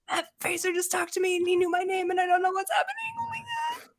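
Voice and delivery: high, breathless starstruck gush; husband starstruck after meeting the star. Retold or imitated. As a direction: gush like a stunned fan. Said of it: "He's like".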